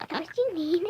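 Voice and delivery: words breaking into giggles; can't get through it for laughing; giggly, high and loud. Real, not acted.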